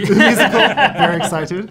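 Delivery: delighted laugh breaking through the words. Real, not acted. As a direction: burst out laughing at the joke.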